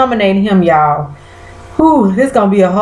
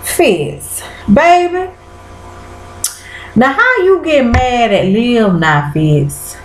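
high, mocking scold; sassy call-out; 4 semitones higher than usual. Real, not acted. To the viewers, scolding Fitz for his hypocrisy. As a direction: call him out with sass. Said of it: "I said".

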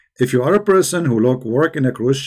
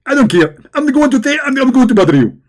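higher, louder excited preacher impression; Zakir Naik; 8 semitones higher, 3 dB louder than usual. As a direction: lecture like a fired-up debating preacher. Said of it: "If we ask Zakir Naik".

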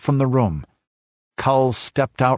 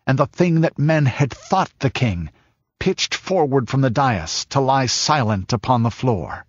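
plain reading voice

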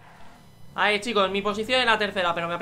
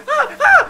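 much higher, loud panicked yelp; startled scream; 16 dB louder than usual. Real, not acted. Streamer crashing in the race, loud high scream. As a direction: yelp in shock as you crash.

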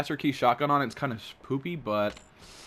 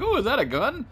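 surprised; 13 semitones higher, 5 dB louder than usual. Retold or imitated. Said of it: "I was like".

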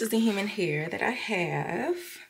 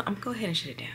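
whispering; 6 dB quieter, much faster than usual. Real, not acted. Whispered signoff.

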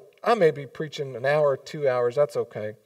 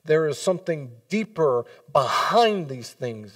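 slow emphatic Paul; 5 dB louder, slower than usual. Retold or imitated. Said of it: "and he goes".

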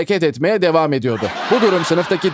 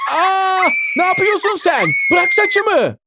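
shocked scream; slower than usual. Acted. Girl shrieking at Caner who pulls her hair, shocked high yell. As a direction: scream it out as she is yanked by the hair. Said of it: "diye bağırdı kız şok içinde".

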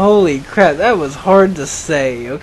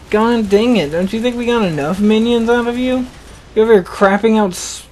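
ordinary delivery

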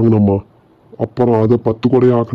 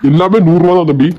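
street hawker call; 6 semitones higher, 3 dB louder than usual. Acted. Hawker answers customer.

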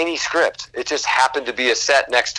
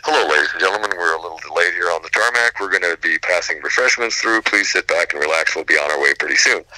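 lower, smooth cabin announcement voice; a lower, steady pilot announcement to passengers; flight voiceover; 8 semitones lower than usual. Retold or imitated. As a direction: play the calm airline pilot on the intercom. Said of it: "please just let me do one voiceover".

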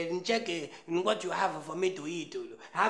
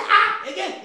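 mock eager call; 10 semitones higher, 10 dB louder, slower than usual. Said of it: "I say".

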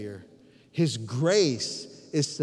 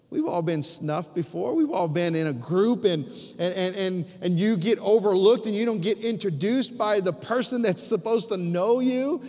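ordinary delivery

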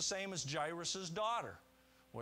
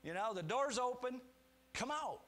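higher, lightly voiced Jesus coaxing Lazarus out; a friendly, higher-pitched nudge through an open door; inviting call; 7 semitones higher than usual. Preacher imagining Jesus coaxing Lazarus from the tomb, higher friendly call. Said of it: "he's saying in a way".

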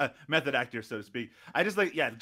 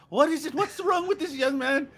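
much higher, louder fussing voice; mock Irish villagers; 14 semitones higher, 6 dB louder than usual. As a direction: demand answers from the old man. Irish crowd questioning Sean John.

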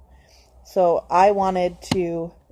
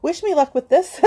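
higher, a laugh breaking into the words; laughing through tears; 7 semitones higher, 6 dB louder than usual. Real, not acted. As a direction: laugh it off after crying.

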